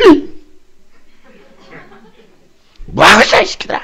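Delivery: angry yell. Retold or imitated. Pastor scolding students on their phones, angry yell. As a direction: roar at the boys in anger. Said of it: "확 소리쳤어요".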